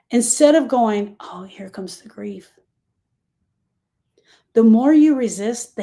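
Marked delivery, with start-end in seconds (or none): 1.2-2.5 breathy whispered aside; whispered mock inner thought. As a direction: hush it like a secret dismissal.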